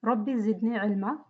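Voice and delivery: quick, soft Arabic recitation; fast, low, formulaic invocation; opening prayer. Real, not acted. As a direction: murmur a familiar prayer at a brisk pace. Opening prayer before the lesson.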